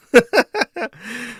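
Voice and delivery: high, loud burst of laughter. Real, not acted. Streamer amused by a comment about teeth, high laugh.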